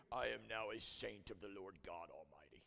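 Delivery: low, put-on English saint voice; deep English accent. Peter suddenly turned saint, mock pious deep English accent. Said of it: "they always have a deep English accent".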